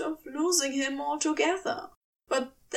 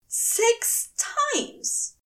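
high, shocked echo; shocked angel; 12 semitones higher than usual. Acted. Aziraphale shocked.

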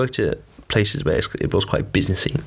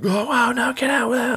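panicked guest. Retold or imitated. Hotel guests when housekeeping opens the door, mock panic. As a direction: imitate a panicking guest in a much higher voice. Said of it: "like, panics".

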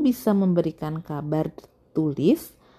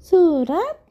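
very high, puzzled storybook echo; high girlish question; questioning character voice; 11 semitones higher than usual. Acted. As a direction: ask it back in a high put-on voice. Bono questions the suggestion, voiced.

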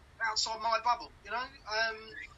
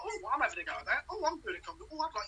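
chirpy newbie voice, higher; imitating keen wannabe comedians; mocking eager newbies; 5 semitones higher, faster than usual. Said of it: "who are".

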